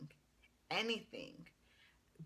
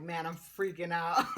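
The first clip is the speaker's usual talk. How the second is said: laughing; 7 dB louder than usual. Retold or imitated. Laughs retelling nerves.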